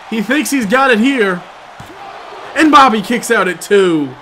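ordinary delivery

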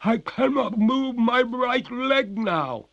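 very high, loud, slow wail; despairing. A narrator's reading. Freezing ghost cries to the master, despairing. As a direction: cry out as a desperate ghost. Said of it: "cried the ghost in despair".

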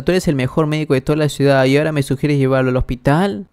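ordinary delivery, nothing marked

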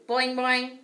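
loud, high springy boings; ping the boings wildly; upset twang. A narrator's reading. Frog splashing in distress, loud springy boings.

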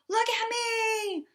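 shouted high and slow, a pig showing off; yelled. Acted. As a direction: yell it out, look at me. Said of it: "and yelled".

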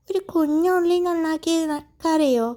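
a little child gently mocking his crying sister; teasing little boy. A narrator's reading. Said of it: "Kannan ചോദിച്ചു".

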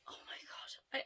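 breathless whispered awe; hushed, amazed whisper; awed whisper. Real, not acted. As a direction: whisper it, stunned by the colour. Loves earrings.

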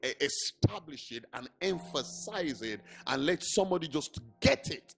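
hushed sermon opening; quiet scripture reading. Acted. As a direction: read it gently and reflectively. Soft devotional reading and teaching.

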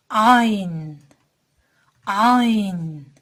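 "Ayn" from a lightly squeezed throat, loud; Arabic letter ayn. Shown as a demonstration. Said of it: "boğaz hafif sıkılarak çıkarılır". (boğazı sıkarak "ayn")

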